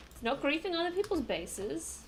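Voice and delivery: ordinary talk, nothing marked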